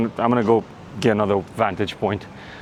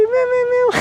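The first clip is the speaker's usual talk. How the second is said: very high meow imitation; squeaky kitten meows. Meowing at a cat.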